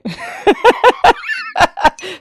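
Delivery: loud, high-pitched burst of laughter; laughing out loud. Real, not acted. Streamer amused by own joke, loud high laugh. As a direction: crack up in a high cackle.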